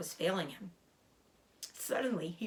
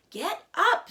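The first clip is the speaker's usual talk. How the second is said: high, loud scream at the rat; 12 semitones higher, 9 dB louder than usual. Acted. Wilbur screams at the lazy rat to get up. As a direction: scream it out, fed up. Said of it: "screamed Wilbur".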